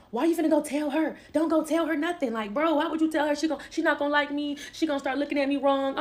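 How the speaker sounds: lightly mocked, worried protest; retold pleading protest. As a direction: imitate a boyfriend pleading not to tell. Retells him protesting telling her mother.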